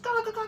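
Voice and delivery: high-pitched imitated crow caws; relentless high crow squawking; cawing crows. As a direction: caw over and over like a flock. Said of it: "just cawing nonstop".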